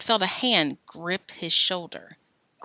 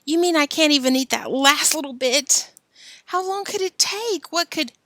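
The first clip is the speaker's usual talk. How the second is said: put-on whining boy; 9 semitones higher, 4 dB louder than usual.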